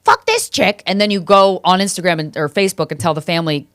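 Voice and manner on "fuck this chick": high-pitched scornful jab; mock news-reader outrage. Imitating a reader judging the driver, high scornful jab. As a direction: sneer it like an internet stranger. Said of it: "and you're like".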